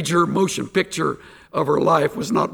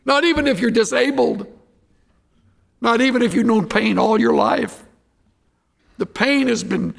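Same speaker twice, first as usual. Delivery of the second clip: preaching; 7 semitones higher than usual. Real, not acted. Impassioned altar call.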